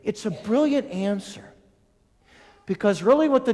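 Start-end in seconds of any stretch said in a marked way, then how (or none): none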